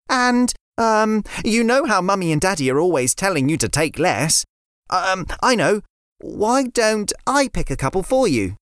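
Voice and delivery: put-on a girl offering biscuits instead; little girl Layla. Layla voiced.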